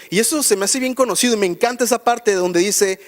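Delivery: usual speaking voice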